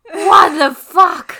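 slow, loud disbelieving outburst; real indignant what the fuck; stunned outrage. Real, not acted. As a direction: drag out the shock. Outraged exclamation.

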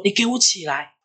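high-pitched scolding, not shouted; fierce father. Retold or imitated. Father ordering the weak child out of bed, fierce and high. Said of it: "对我超凶".

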